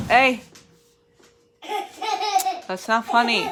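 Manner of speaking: calling out a scolding. Real, not acted. Parent breaks off to the kids who pushed, sharp real scolding. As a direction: pitch up and call out a reproach.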